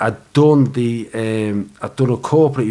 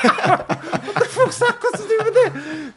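a slow, incredulous line half laughed out, pitched far higher; laughing disbelief. Real, not acted. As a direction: laugh through the outrage at the absurd note. Disbelief at the feedback.

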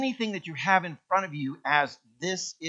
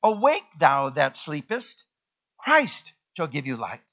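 scripture proclaimed; 3 semitones lower, 6 dB louder than usual. Acted. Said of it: "the scripture says".

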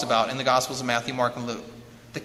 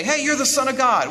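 higher, mock demon outcry; demons calling out; 7 semitones higher, 4 dB louder than usual. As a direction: blurt it out like a demon exposing someone. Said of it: "when the demons say".

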